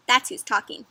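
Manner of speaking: ordinary delivery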